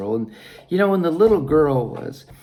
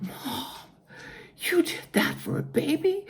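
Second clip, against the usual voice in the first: put-on much higher, softer child's voice, astonished; amazed little girl; 13 semitones higher, 4 dB quieter than usual. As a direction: ask in wide-eyed wonder. Girl amazed at mother's story.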